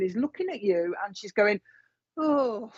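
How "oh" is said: imitated puffed-out "oh" with a sigh; huffing and puffing. Medium acts out the mum looking at Valerie, huffing and puffing. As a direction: sigh it out as if winded. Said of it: "huffing and puffing".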